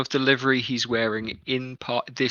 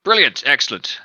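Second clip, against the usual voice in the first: guard captain; 3 semitones higher, 6 dB louder than usual. Acted. Captain again.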